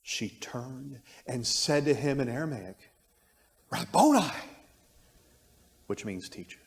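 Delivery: measured, expressive Bible reading; scripture reading. Acted. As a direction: read the Gospel to the class with feeling. Reads the Gospel passage aloud.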